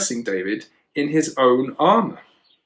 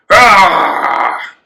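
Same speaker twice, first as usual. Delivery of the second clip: loud, higher giant's roar; booming mocking giant voice; bellowing giant; 4 semitones higher, 7 dB louder, slower than usual. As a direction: bellow it to taunt the soldiers. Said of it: "the giant bellowed".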